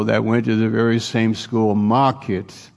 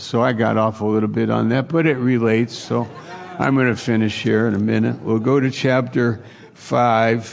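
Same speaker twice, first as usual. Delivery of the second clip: ordinary talk, nothing marked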